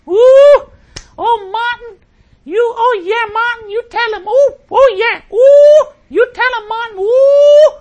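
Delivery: very high falsetto hums and whoops; hammed-up crowd shrieking encouragement; mock ecstatic congregation. Host imitates the audience during the speech, mock frenzied cheering. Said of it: "And they'd all go".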